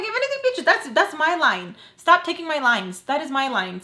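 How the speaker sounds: high, loud retelling of a quarrel; indignant comeback. Retold or imitated. Acts own indignant comeback to Sam.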